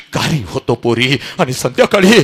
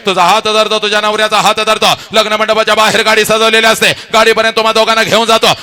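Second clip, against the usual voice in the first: ordinary delivery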